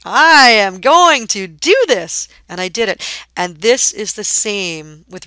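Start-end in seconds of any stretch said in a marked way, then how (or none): 0.0-2.3 slow, loud and higher resolve; drawn-out, forceful self-pep talk; determined declaration. Retold or imitated. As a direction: say it like a vow to yourself. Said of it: "I was like".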